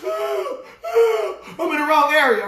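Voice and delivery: imitating a panicked wail, cracking up high; hammed-up cry of someone trapped; mock crying wail. Preacher mimicking a stuck believer, mock wail. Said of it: "And now you saying".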